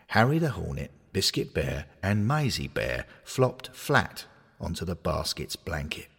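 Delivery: ordinary delivery, nothing marked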